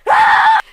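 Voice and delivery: loud, high scream of agony; hammed-up shriek of pain; mock pain scream. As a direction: scream as if just shot. Imitates character shot in finger.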